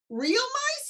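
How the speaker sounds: hammed-up shocked squeal; mock shock. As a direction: act appalled at the idea. Retold shocked question.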